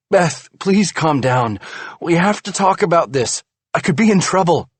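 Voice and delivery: anxious plea. A narrator's reading. Husband pleads with wife, worried about trouble. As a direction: plead with her urgently.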